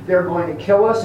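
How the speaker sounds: usual speaking voice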